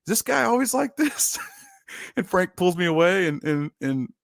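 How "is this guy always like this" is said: puzzled opponent. Retold or imitated. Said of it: "he was like".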